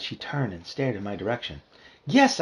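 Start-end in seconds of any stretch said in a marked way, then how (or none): 2.0-2.4 high, loud eager yes; excited cry. A narrator's reading. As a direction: cry out with delight. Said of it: "I cried excitedly".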